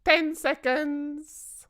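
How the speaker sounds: high, slow witch voice; a gloating witch counting down; witch's cry. A narrator's reading. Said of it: "cried the Grand High Witch".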